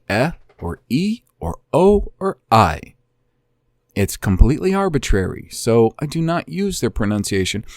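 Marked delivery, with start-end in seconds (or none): 0.1-2.9 "eh", "e", "o", "I" listed as separate sounds; vowel sounds demonstrated. Shown as a demonstration. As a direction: set each vowel "eh", "e", "o", "I" apart. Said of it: "that it'll be pronounced".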